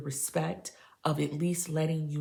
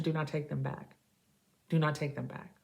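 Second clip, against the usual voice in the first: ordinary talk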